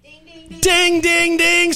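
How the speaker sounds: high sung ding ding ding like a bell; mock bell ding. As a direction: chime out the winner. Winner announced.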